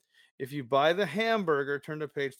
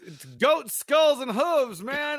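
mock outrage; 8 semitones higher, 7 dB louder than usual. To his friend about the dog food, in hammed-up outrage. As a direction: ham up the disgust over goat skulls.

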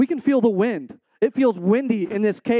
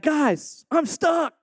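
mild mock yell; 9 semitones higher, slower than usual. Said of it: "I'm just yelling back".

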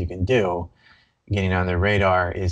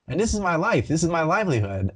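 impassioned plea; 8 semitones higher than usual. Retold or imitated. Telling the guards why he came, high insistent plea. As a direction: pitch jumps high, insistent. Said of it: "I was like".

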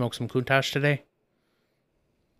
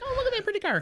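very high, softer cooing quote; retold delight; 5 dB quieter, much faster than usual. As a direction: act out being charmed, pitched way up. Retells own delighted reaction.

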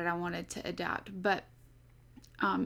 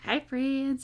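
higher-pitched mock country twang; country accent; 6 semitones higher, 4 dB louder than usual. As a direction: imitate his countryest accent. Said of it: "in his countryest accent".

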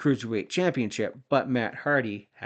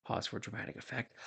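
quick softer whisper; whispered aside; 14 dB quieter, faster than usual. Real, not acted. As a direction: toss it off under your breath, fast. Aside inside retold promo.

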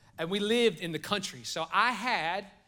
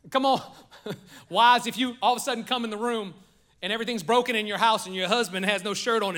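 ordinary talk

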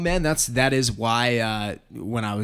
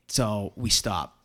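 usual speaking voice

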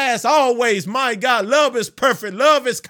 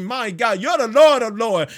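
ordinary talk

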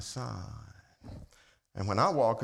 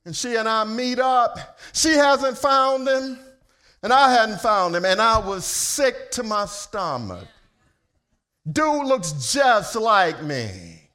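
high, loud sermon storytelling; full-force preaching; 10 semitones higher, 10 dB louder than usual. Acted. Preacher telling congregation of lost son, full-force preaching. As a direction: preach it to the rafters.

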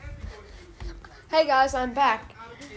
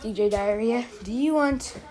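ordinary delivery

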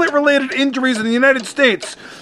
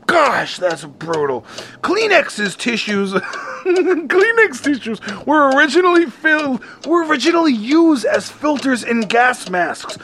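usual speaking voice

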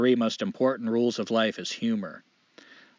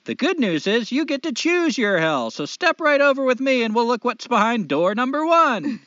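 higher, louder, slow showman devil; 9 semitones higher, 5 dB louder than usual. Acted. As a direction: greet him like a grinning host of hell. Devil character voiced in joke.

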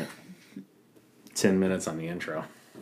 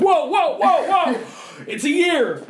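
excited; 10 dB louder than usual. Retold or imitated. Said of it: "you're like".

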